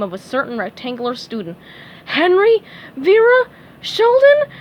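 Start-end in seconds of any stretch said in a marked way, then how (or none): 2.0-4.5 each name higher and louder; desperate calling out. A narrator's reading. Teacher desperately calls names, climbing higher and louder. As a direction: cast names out in rising desperation. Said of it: "she called out in desperation".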